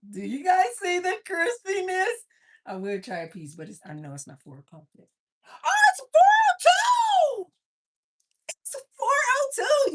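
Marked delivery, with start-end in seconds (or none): 0.1-2.3 high, loud, stretched-out question; excited. Real, not acted. Excited showing off the chicken. 5.5-9.9 high, loud delighted yell; excited shout. Real, not acted. Realizes it's past four.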